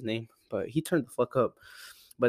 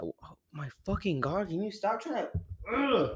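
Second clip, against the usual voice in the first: annoyed at cat; 8 semitones higher, 5 dB quieter than usual. Real, not acted. Scolding his cat mid-rant.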